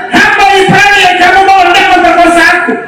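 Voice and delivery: high-pitched mock outrage; accusing wife. Preacher imitating Potiphar's wife accusing Joseph, high mock accusation. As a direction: play the indignant wife, high and hammed-up.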